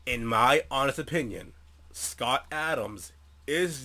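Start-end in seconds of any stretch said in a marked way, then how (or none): none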